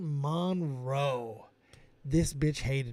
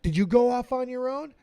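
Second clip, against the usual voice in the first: higher, insistent grilling of the recruit; drill sergeant interrogating; 7 semitones higher, 4 dB louder than usual. Retold or imitated.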